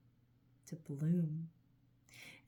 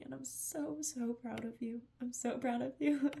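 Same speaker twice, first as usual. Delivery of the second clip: emotional; 6 semitones higher than usual. Real, not acted. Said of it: "emotions are bubbling up right now".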